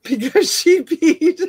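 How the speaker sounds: giggling through the words; laughing. Real, not acted. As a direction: laugh as you say it. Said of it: "laughs while saying it".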